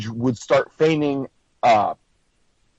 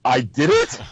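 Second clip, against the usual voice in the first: "I did it" turned up at the end like a question; questioning uptone; 3 semitones lower than usual. Acted. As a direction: lift "it" as if asking. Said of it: "you went up at the end".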